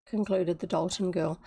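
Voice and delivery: ordinary delivery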